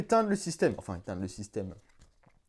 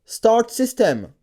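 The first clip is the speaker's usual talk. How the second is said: slow, louder, over-enunciated command; spoken voice command; 5 semitones higher, 6 dB louder, much slower than usual. Real, not acted. Giving the helmet a voice command, slow and deliberate. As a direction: say it slowly so the machine understands.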